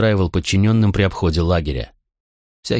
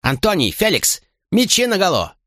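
calling out order; 12 semitones higher than usual. A narrator's reading. Commander calling guards to arms.

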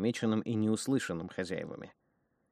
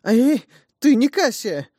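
frightened cry; 13 dB louder, slower than usual. Acted. Woman grabbed by her hair.

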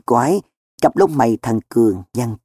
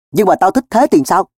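higher-pitched defiant retort; raised, contrary comeback; stubborn defiance; 7 semitones higher than usual. A narrator's reading. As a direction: argue back stubbornly. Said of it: "Cường gân cổ".

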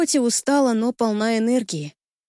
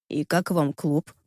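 low seductive voice; 5 semitones lower, slower than usual. A narrator's reading. Danil's low voice, slow.

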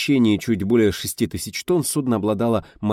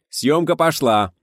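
loud, higher, slow call; 8 semitones higher, 6 dB louder, slower than usual. A narrator's reading. Director starting the shoot, called out loud. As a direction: announce it to the whole set.

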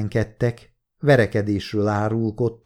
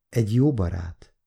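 mildly hesitant, questioning answer; timid smile. A narrator's reading. Tonka answers Gyuri's challenge, smiling timidly. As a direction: offer it tentatively, with a small smile. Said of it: "mosolygott bátortalanul Tonka".

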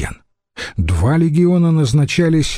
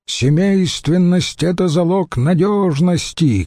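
mild mock shout; 7 semitones higher than usual. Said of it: "крикнул Катон".

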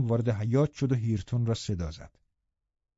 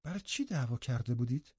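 soft, deflated question; subdued young girl's disappointment; crestfallen; 10 dB quieter than usual. A narrator's reading. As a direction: ask quietly, let down. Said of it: "کاملاً دمق گفت".

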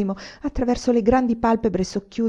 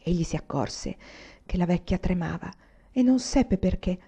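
usual speaking voice

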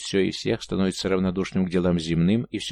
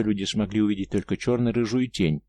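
plain reading voice

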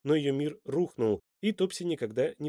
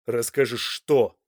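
loud, slow, tense question; tense, alarmed; 7 dB louder, slower than usual. A narrator's reading. Son questions his unwell mother, tense and alarmed. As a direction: press anxiously for the answer. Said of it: "напряженно спросил Данте, явно встревоженный".

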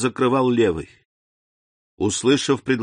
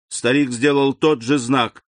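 ordinary delivery, nothing marked

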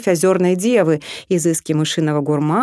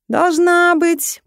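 поддакивающий ворон; 11 semitones higher, much slower than usual. Acted. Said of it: "поддакнул огромный ворон".

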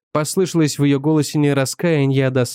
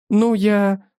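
wife's voice; 6 semitones higher than usual. A narrator's reading. Wife voiced high.

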